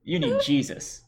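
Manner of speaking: preachy sarcastic jab. Acted. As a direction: say it like a smug preacher. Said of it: "I'm trying not to say sarcastically".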